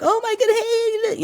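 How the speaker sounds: very high, slow head voice; talking to your dog. Shown as a demonstration. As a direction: squeal it up high like greeting a puppy. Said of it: "when you're talking to your dog".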